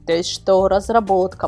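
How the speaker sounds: ordinary delivery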